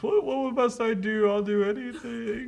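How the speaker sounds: high, anxious pleading; frightened boy. Acted. Thomas begging the ghost, high and frightened. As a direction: beg desperately.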